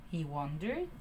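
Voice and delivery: plain reading voice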